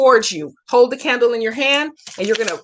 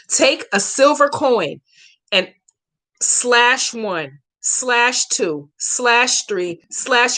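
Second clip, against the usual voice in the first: slowly; much slower than usual. Shown as a demonstration. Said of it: "let me say it again slowly".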